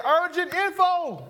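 high, slow, emphatic "Urgent info"; call for repeat. Shown as a demonstration. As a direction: lean on "Urgent info" so the crowd echoes it. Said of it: "Everybody say".

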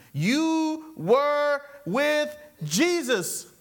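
"You were with Jesus" high and very drawn out; emphatic accusation. Acted. Accuser recognizing Peter, "You were with Jesus" said word by deliberate word.

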